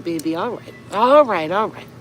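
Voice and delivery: ordinary talk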